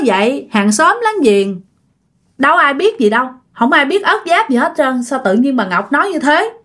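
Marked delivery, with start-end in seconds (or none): none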